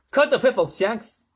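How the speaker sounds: loud, high, lisping snap; irritated lisping President; snapped. A narrator's reading. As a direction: cut him off testily. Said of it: "snapped the President".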